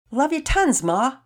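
put-on excited kid's loud declaration of love; enthusiastic. A narrator's reading. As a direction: gush it out loud and high to Mom. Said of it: "Todd said enthusiastically".

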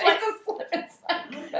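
high, slow, laughing through the words; cracking up at her own joke. Real, not acted. Friends joking about conventions, laughing.

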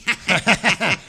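loud villain cackle; evil laugh. Acted. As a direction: cackle over the evil plan. Villain laughs at his plan.

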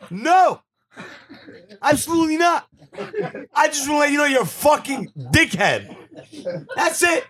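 high, emphatic mock defiance; retold defiant comeback. His acted defiant answer.

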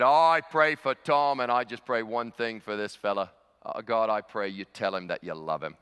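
earnest mock-Australian prayer with deliberate pauses; Australian accent. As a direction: imitate a blunt Australian bloke praying. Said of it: "Australian accent or not".

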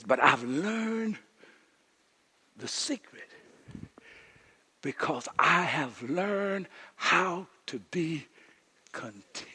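drawn-out hushed reading; slow quiet quote. Retold or imitated. Preacher quoting Paul, slow and hushed. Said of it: "He says".

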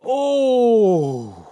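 a loud, drawn-out oh; excited gasp. Real, not acted. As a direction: gasp as the ball drops. Tense moment, shot goes in.

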